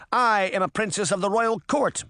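high, indignant princess; demanding. A narrator's reading. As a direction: declare your rank as an outraged demand. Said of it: "Moth demanded".

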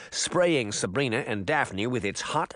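ordinary delivery

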